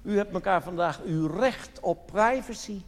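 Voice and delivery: ordinary talk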